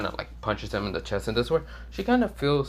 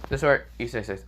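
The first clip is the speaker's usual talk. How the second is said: ordinary delivery, nothing marked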